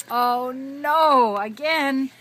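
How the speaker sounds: high, loud singsong storytelling; broad singsong kids theatre. A narrator's reading. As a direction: ham it up for a toddler. Reading to a toddler.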